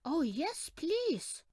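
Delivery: put-on high, eager little boy; eager child. A narrator's reading. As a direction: beg eagerly like a small boy. Larry answers eagerly.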